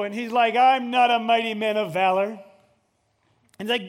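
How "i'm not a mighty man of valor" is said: loud protest. Acted. Gideon protests to angel.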